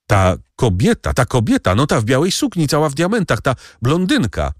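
high-pitched, stammering shock; frantic pointing-out, pitched high; agitated alarm. Acted. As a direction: blurt it out in rising agitation. Bertuccio recognises the woman, shocked.

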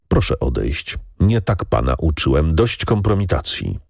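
usual speaking voice

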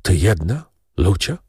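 understated disappointed question through the teeth; resigned disbelief, lightly rising. A narrator's reading. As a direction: force it out with restrained bitterness. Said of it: "cisnął przez zęby".

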